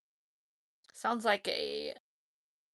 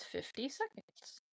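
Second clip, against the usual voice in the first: usual speaking voice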